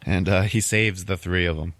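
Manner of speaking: ordinary talk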